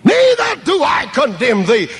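high, loud pulpit proclamation; proclaimed. A narrator's reading. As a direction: ring it out over the congregation. Same words repeated proclaimed.

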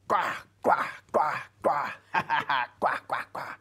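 put-on loud, high quacking baby noises with a laugh; imitated baby squawk. Mother imitating her daughter hitting her face, playful squawking. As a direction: squawk "gwa" in quick bursts like a baby. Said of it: "She's like".

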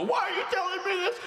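high, fast wailing cry; hammed-up distraught shriek; mock anguished wail. Imagined sick boy upset by visitor's bluntness, mock wail.

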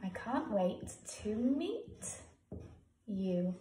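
drawn out slowly, word by word; slow dictation. Shown as a demonstration. Teacher writing the letter aloud for pupils, slow. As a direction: spell it out at writing speed. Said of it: "teacher writing aloud".

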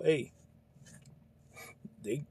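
ordinary talk, nothing marked

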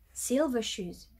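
usual speaking voice

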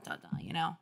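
ordinary delivery, nothing marked

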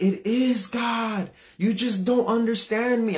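put-on high, drawn-out teenage whine of protest; frustrated insistence. Teenage son arguing with his mom, frustrated. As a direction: insist desperately, pitch way up. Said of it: "getting so frustrated".

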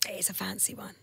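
hushed unvoiced whisper. Real, not acted. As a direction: whisper it like a secret.

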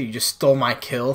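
usual speaking voice

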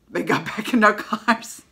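normal talking voice

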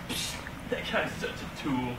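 softly whispered mocking aside; whispered gossip about the nerd. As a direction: hiss it behind a hand.

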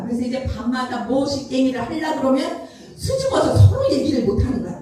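usual speaking voice

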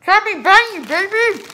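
cheeks stuffed full. Real, not acted. Marshmallows in mouth each round.

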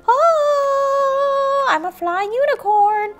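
very high, airborne toy voice; flying unicorn. Pretend. Unicorn figure takes flight, high gleeful pretend voice.

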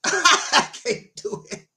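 laughing through the words, slowed down; cracking up while refusing. Real, not acted. Preacher to the Facebook audience, laughing. Laughing at own shower story.

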